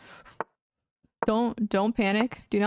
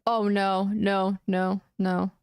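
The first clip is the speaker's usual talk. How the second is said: normal talking voice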